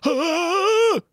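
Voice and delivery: high, held mock scream; mock horrified cry. Student seeing hair and scalp peel away, mock scream.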